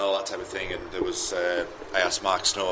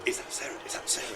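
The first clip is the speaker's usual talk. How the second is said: breathless whispered asking; eager whisper; 6 semitones higher, 6 dB quieter, faster than usual. Retold or imitated. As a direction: whisper it, straining to hear. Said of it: "Karen was literally going".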